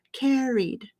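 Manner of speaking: "carried" with a long, clear ee before the d. Shown as a demonstration. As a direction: stretch "carried" as CARE-eed. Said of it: "you still have a long E sound".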